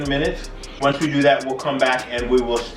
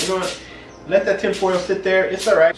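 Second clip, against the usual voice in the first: breezy, higher don't-care tone; carefree; 8 semitones higher than usual. Retold or imitated. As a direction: shrug it off lightly. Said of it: "I just, like".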